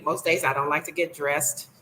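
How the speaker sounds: normal talking voice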